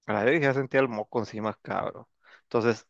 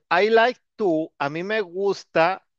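slow teacher modelling; slow model phrase; 3 semitones higher, slower than usual. Shown as a demonstration. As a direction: say it slowly, drawn out.

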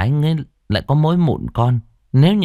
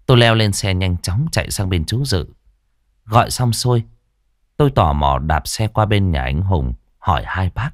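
ordinary delivery, nothing marked

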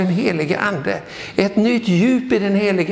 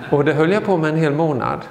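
normal talking voice

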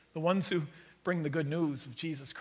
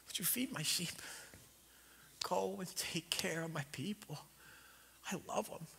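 hushed Jesus; 13 dB quieter than usual. Retold or imitated. Jesus quietly asks Peter to care for his people, whispering. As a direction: lean in and whisper it gently.

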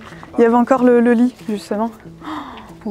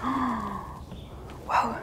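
hushed whisper, slow; 8 dB quieter, much slower than usual. Real, not acted. Exploring a forbidden site, hushed whisper. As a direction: whisper so no one hears. Sneaking through abandoned houses.

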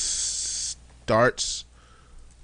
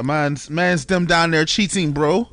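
put-on London slang accent; 5 semitones higher, slower than usual. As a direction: drawl it like a London roadman.

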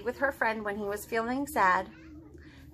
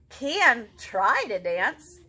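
slow, deliberate, deep resolve; determined Gerald; 4 semitones lower, 6 dB louder, much slower than usual. Acted. Gerald tells Piggy slowly that he will dance. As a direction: plant each word like a vow.

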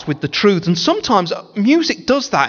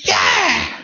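excited shout; 13 semitones higher than usual. Retold or imitated. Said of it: "Alma will be like this".

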